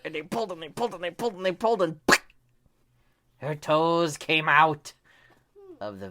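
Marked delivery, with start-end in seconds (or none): 0.0-2.0 building excitement. A narrator's reading. Building tug-of-war climax. 3.4-4.9 climactic release. A narrator's reading. Story climax.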